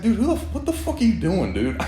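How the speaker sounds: genuinely fed up and baffled; exasperated. Real, not acted. Exasperated at macho behaviour.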